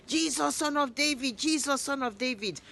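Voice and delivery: insistent high cry. A narrator's reading. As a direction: keep crying out though they shush him. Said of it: "he keeps on shouting".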